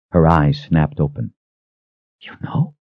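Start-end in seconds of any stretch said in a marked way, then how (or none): 2.2-2.7 breathless, startled whisper. Acted. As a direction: gasp it out in shock. Eyes snapped open, startled.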